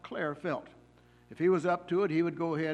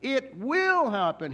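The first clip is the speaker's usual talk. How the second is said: "It will happen" much higher, louder and slow; emphatic preacher quote; 9 semitones higher, 9 dB louder, slower than usual. Retold or imitated. Quoting an old preacher to the congregation, ringing out loud. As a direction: proclaim "It will happen" as a certainty. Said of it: "he would say".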